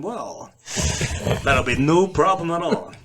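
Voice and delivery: exaggerated all-American guy voice. Shown as a demonstration. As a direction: put on a smooth, overly proper American accent.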